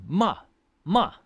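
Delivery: MA high and loud, falling sharply; sharp downward MA, like a telling-off; scolding fourth tone. Shown as a demonstration. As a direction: scold a kid with each MA. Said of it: "like you would scold a kid".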